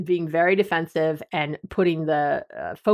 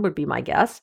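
normal talking voice

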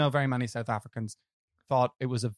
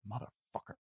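very soft, lower, barely voiced curse; mouthed curse; 4 semitones lower, 14 dB quieter than usual. Imitating Samuel L. Jackson losing the Oscar, barely voiced. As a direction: mouth it almost silently. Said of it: "you just see his lips just go".

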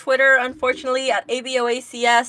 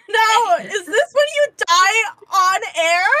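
giggling high through the words; laughing; 9 semitones higher, 4 dB louder than usual. Real, not acted. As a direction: laugh while teasing. Laughing at choking partner.

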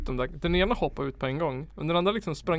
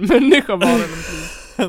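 loud, breaking into laughter; laughing; 4 semitones higher, 11 dB louder than usual. Real, not acted. Owner telling friends about the terrified cat, laughing. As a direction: let a real laugh burst through mid-sentence. Laughs while recalling the cat's terror.

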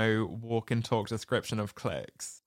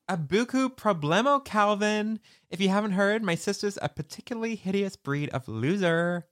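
higher, lightly voiced snooty teen girl; a hint of a stuck-up schoolgirl; snooty teen quote; 9 semitones higher than usual. Retelling Bianca's jab at Cameron, mildly snooty voice. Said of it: "Very Kate Saunders".

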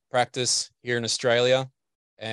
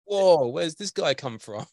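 higher, drawn-out astonished reaction; mock amazement; 6 semitones higher than usual. Interviewer voicing Canadians on the singer's return, mock amazement. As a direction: imitate people taken aback, slowly. Voices people back in Canada, surprised.

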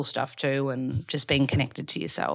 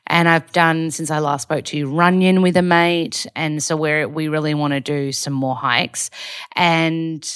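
ordinary delivery

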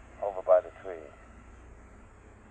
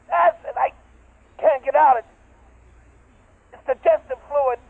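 panicked screaming; 11 semitones higher, 4 dB louder than usual. Acted. Trapped, being digested.

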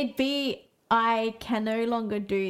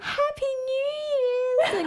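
slow, much higher wailing cry; drunken wail; much slower than usual. Retold or imitated. Drunk girl shouting new year greetings down the phone, wailing. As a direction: drag it out, half crying, drunk. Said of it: "I just remember going".

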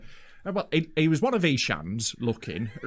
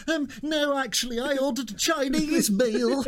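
confused posh reply; 9 semitones higher than usual. Retold or imitated. Retelling his own confused reply.

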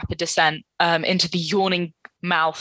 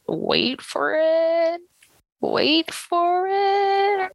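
drawn out, much higher and slower; slowing down to reveal; 10 semitones higher, much slower than usual. Acted. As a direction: stretch every word, teasing the reveal. Drawn-out suspense.

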